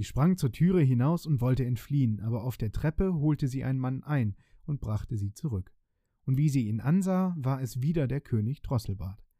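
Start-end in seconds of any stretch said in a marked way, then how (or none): none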